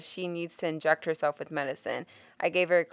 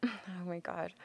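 breathless disbelieving whisper; 15 dB quieter than usual. Real, not acted. Reacting to cussing.

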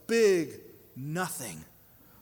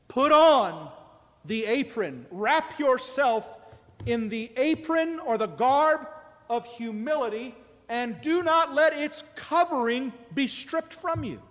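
preaching Peter's words; 5 semitones higher, 3 dB louder than usual. Acted. Quoting Peter at full preaching force.

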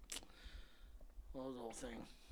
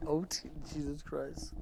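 normal talking voice

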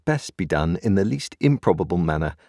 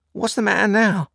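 high, louder and smartly put; smart, sharp; 5 dB louder than usual. A narrator's reading. Sister noticing Joe staring at the boy, smartly. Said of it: "said she, smartly".

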